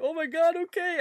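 high, loud mock-crying surrender; hammed-up tearful wail; tearful giving in. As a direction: imitate a panicked kid giving in. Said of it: "with tears in my eyes".

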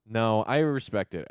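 ordinary delivery

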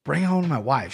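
ordinary delivery

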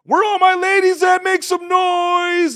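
high, loud hype-man shout; loud high slow MC shout; concert hype host. Retold or imitated. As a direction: whip the crowd up like a concert host. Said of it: "I went into concert hosting mode".

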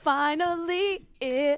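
mock swoon. Friend joining the story, a high mock swoon. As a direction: stretch it out like a lovestruck sigh.

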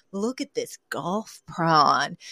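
soft, slow, admiring the shrimp; admiring hush. Retold or imitated. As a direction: hush it like a connoisseur. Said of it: "picks up a large shrimp and goes".

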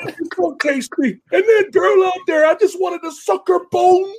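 high squealing goblin shout; high goblin yell; pig goblin voice. Retold or imitated. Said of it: "the pig goblin's all like".

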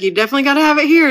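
quoting him, high, loud and fast; mock-eager friend insisting; excited urging. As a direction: push it like you can't wait for the party.

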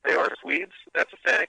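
robotic phone line, toneless and degraded; bad phone connection. Real, not acted. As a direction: voice broken up by the phone. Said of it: "your phone makes it sound like".

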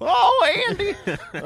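a squeaky high laughing cry of Andy; Don Knotts voice. Retold or imitated. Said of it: "It slid into Don Knotts there for a second".

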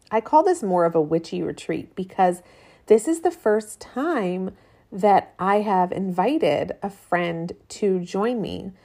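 usual speaking voice

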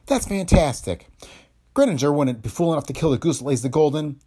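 lightly voiced scoffing actress; dismissive woman. Woman character voice.

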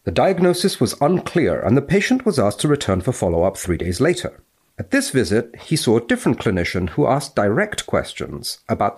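crisp academic recitation; formal read-aloud. Acted. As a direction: read it out like the journal text. Reading the case report aloud.

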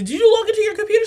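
high, fast, laughing disbelief; mom, did you log in, asked high and fast with a laugh; laughing alarmed question. Retold or imitated. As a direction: fire it off half laughing. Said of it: "I said".

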